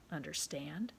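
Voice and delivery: a hushed, breathy "understand?"; whispered warning. Acted. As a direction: drop to a whisper to make it sink in. Owner warns boy quietly.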